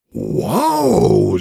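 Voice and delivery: long swooping wow; delighted boy, voiced by the narrator; thrilled. A narrator's reading. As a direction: wow with real enthusiasm. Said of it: "sagt er begeistert".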